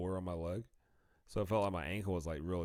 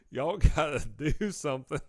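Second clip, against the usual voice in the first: louder, higher retold plea; urgent demand; 6 semitones higher, 10 dB louder than usual. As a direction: demand help through the pain. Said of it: "I was like".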